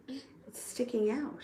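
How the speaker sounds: slow, breathy whisper to herself; whispered aside. Real, not acted. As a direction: whisper it slowly, just for yourself. Aside about her hair.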